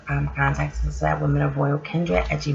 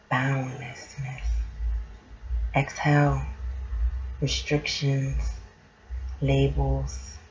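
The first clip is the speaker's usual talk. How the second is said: guided meditation; 3 semitones higher, 4 dB quieter than usual. Acted. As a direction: guide them gently into calm. Leads a mindful moment.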